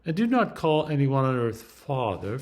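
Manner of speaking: ordinary delivery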